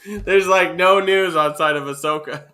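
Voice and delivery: high, loud words through laughter; laughing. Real, not acted. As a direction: talk while cracking up.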